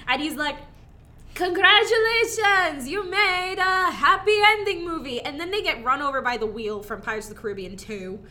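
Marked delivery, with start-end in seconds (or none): 1.7-5.2 high, loud, foreign-sounding Borat voice; Borat impression. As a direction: do Borat congratulating them. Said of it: "'cause he's Borat".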